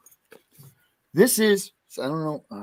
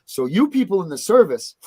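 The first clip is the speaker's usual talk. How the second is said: usual speaking voice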